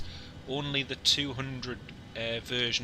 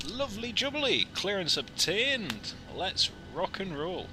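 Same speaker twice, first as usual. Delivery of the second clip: high, loud celebration, slowing at the end; excited cheer; 7 semitones higher, 3 dB louder than usual. Real, not acted. Pilot cheering his ATC clearance, excited and high. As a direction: whoop it up with real delight.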